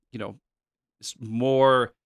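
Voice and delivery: ordinary talk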